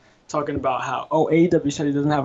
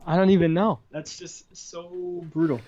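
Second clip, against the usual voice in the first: ordinary delivery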